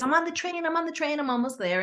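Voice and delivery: breathless mock hurry, up high; hurried client. Client texting that she is running late, hurried high voice. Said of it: "She goes".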